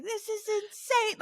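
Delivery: very high, drawn-out mock yell; losing it. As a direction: squeal it in disbelief without real volume. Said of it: "I lost my mind".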